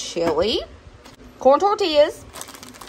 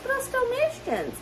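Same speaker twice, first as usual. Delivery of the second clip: much higher, softer, playful squeak; high cutesy voice; 14 semitones higher, 5 dB quieter than usual. Pretend. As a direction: say it sweetly, way up high. Repeats the greeting in a new voice.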